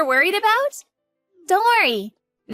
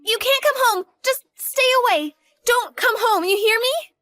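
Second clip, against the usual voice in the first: panicked; 5 semitones higher than usual. Acted. Daughter panics as father arrives.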